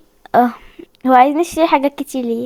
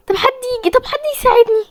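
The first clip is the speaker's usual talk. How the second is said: high, strained desperate call for help; fearful high cry for help; pleading for help; 9 semitones higher, faster than usual. Real, not acted. Calling for help in game.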